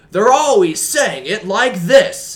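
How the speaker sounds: loud, slow, high-pitched announcer imitation; mock reality TV narrator, drawn out and shouted; yelling reality-show narrator. Friend mocking mom's reality shows, yelling narrator voice. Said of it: "the narrators are always yelling".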